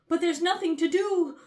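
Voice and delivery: plain reading voice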